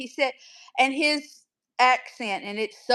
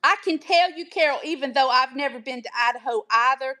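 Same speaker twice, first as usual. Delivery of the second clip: ordinary talk, nothing marked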